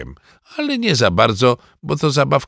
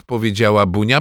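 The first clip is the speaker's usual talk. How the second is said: ordinary delivery, nothing marked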